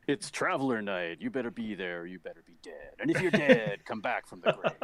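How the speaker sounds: booming hype announcer voice; monster truck ad. Pretend. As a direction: sell it like a monster truck rally promo. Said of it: "Should be some kind of monster truck intro".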